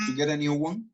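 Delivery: usual speaking voice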